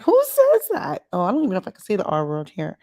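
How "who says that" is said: much higher, louder, slowed in disbelief; shocked outrage. Retold or imitated. Retelling her reaction to the tennis coach, shocked outrage. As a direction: squeal it in disbelief. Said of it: "I'm like".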